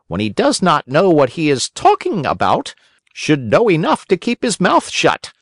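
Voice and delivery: high, scolding little voice; blunt talking cricket. A narrator's reading. Talking cricket scolds the doctors, high and blunt.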